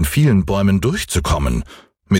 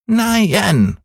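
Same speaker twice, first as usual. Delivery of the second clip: Häwelmann crying out; 12 semitones higher than usual. A narrator's reading. Boy refusing the old moon, high childish cry. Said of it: "schrie Häwelmann".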